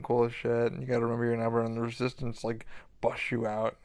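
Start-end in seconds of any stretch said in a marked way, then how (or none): none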